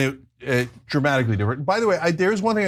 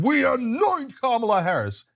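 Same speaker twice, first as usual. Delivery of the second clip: pompous proclamation; 10 semitones higher than usual. Retold or imitated.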